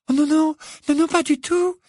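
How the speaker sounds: high, small and timid. A narrator's reading. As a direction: squeak out a nervous yes. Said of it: "d'une petite voix aiguë".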